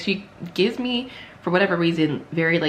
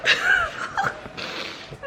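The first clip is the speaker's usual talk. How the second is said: embarrassed laugh; 5 dB quieter than usual. Real, not acted. Embarrassed over burning eyes.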